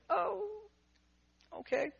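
high, soft, crestfallen; deflated small voice. Retold or imitated. Told another family wants the dog, he answers high and soft. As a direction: let the hope drain out of it. His deflated reply to the staff.

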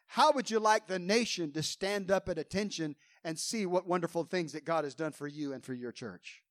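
ordinary delivery

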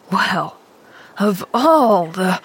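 slow, indignant man, breaking off; exasperated. A narrator's reading. Man astonished by the child's boldness, exasperated. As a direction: splutter slowly in disbelief, leave it unfinished.

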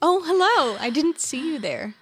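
mock surprised greeting.

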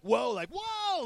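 sharp high startled whoa; freaked-out whoa. Retold or imitated. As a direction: gasp it out like something spooked you. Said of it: "Now, not like".

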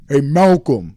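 big, loud booming call; imitating a big man's hearty holler. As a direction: boom it out over a crowded room. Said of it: "over this booming voice over everyone".

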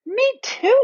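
breathless fright. Acted. Lucy pulled by unseen magic.

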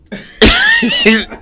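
loud high burst of laughter; big laugh. Real, not acted. Laughs at his own joke.

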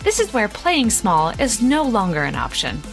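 podcast intro read. Acted. Recorded podcast intro over music.

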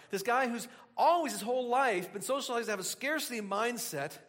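ordinary delivery

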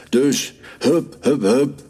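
high, springy string of orders; urging command. A narrator's reading. Boss hurries the dog after the hare, urging. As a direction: chivvy the dog along.

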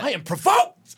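high mock preacher cry; mock provoked preacher. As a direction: echo the sermon's outrage for a laugh. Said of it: "[name] is provoked".

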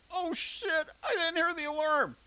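high, alarmed yell with a cry in it. Acted. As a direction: jolt awake and yell in dismay.

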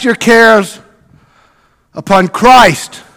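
loud, ringing preacher's call from the pulpit; full-force preaching. Acted. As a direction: preach it out big to the whole church.

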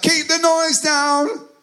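high, drawn-out complaint; mock scolding. Adult telling noisy child off, mock scolding. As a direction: pitch it way up and stretch it out. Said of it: "you're like".

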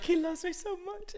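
put-on tiny, very high child squeal; supersonic little girl. Daughter insisting the rabbit loves her, supersonic. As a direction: imitate a gushing kid way up high. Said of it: "her voice goes, like, supersonic".

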